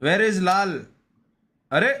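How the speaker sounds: ordinary talk, nothing marked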